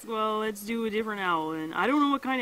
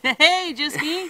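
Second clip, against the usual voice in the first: shout-out call; 7 semitones higher, 9 dB louder, much slower than usual. Real, not acted. Laughing shout-out to a viewer.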